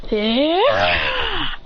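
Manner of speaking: high, drawn-out yell; acted battle cry. Acted cry while electrocuting.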